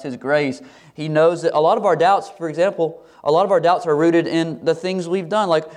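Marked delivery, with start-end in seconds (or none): none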